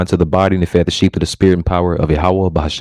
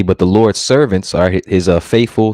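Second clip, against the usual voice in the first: ordinary talk, nothing marked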